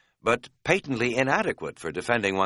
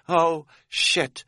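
high, drawn-out alarm; frightened high groan of words; dismayed; 10 semitones higher, 4 dB louder than usual. A narrator's reading. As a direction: curse in dawning dread. Sees a second bear.

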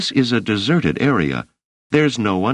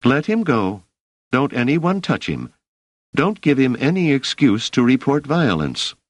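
put-on raised, commanding young woman; higher and raised, a firm order to the crowd; 9 semitones higher than usual. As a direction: hold the crowd back, voice lifted and higher. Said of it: "Dorrs raised her voice".

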